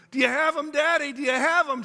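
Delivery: put-on high, pleading child's voice asking her dad; anxious little girl. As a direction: voice a scared little girl checking on her daddy.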